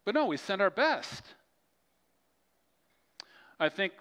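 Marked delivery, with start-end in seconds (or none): 0.1-1.2 higher-pitched, emphatic and earnest; sincere. Real, not acted. Preacher urging church to send its best, sincere. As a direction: drop the sarcasm and insist. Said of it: "That was sarcasm".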